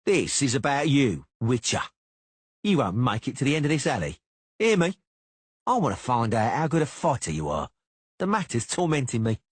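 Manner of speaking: cocky swordsman spoiling for a fight; menacing duelist. A narrator's reading. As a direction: goad him into a fight. Cicada threatens Geralt.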